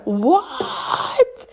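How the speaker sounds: very high, stretched rising what; acted-out astonishment at a denial; incredulous. Reacting to repeated denial.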